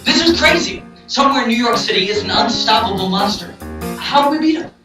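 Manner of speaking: high, worked-up outburst; agitated. Acted. Bros alarmed discussing monster.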